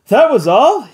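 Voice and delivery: plain reading voice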